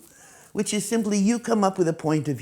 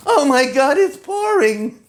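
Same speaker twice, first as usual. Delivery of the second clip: high, loud, slow wail of dismay; horrified the gown will be ruined; shrieking oh my God it's pouring; 10 semitones higher, 9 dB louder than usual. Shown as a demonstration. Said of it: "go somewhere in an evening gown".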